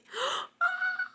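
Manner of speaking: a high delighted gasp; sharp high gasp. Real, not acted. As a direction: gasp in awe. Sees something adorable.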